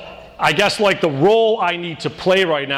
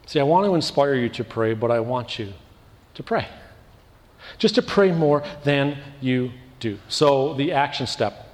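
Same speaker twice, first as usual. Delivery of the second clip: normal talking voice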